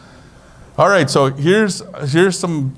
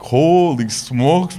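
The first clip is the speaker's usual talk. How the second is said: slow, drawn-out "Holy smokes" in awe; awed, slow "Holy smokes"; amazed; much slower than usual. Retold or imitated. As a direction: stretch out "Holy smokes" like you can't believe it. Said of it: "I'm like".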